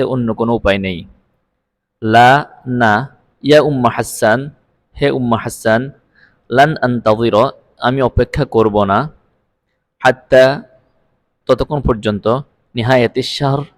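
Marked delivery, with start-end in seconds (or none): none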